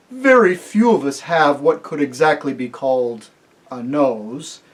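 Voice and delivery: she-elephant musing. A narrator's reading. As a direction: ponder aloud. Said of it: "she-elephant".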